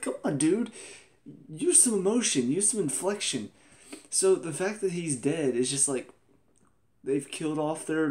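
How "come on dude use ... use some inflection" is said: higher-pitched, pleading exasperation; exasperated plea. Acted. Reviewer urging a dull actor to show emotion, exasperated. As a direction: beg him to liven up, pitched high.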